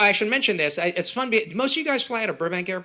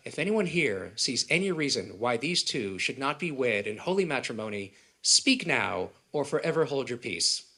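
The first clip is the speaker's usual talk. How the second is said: solemn minister; 5 semitones lower, 6 dB quieter than usual. Retold or imitated. Minister's wedding line quoted.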